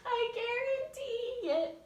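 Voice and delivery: put-on silly girl. Shown as a demonstration. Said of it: "put her hands out like this".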